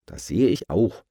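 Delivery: usual speaking voice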